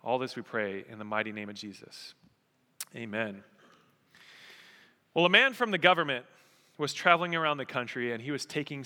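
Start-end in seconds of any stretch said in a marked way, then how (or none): 0.0-3.4 prayer. Real, not acted. Pastor closing a prayer before the sermon, hushed and measured.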